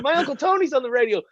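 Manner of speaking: put-on high, eager boasting child; excited kid. Boy telling classmates his uncle is on radio, excited. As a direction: brag to every kid at recess. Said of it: "I started telling everyone".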